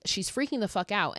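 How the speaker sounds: ordinary talk, nothing marked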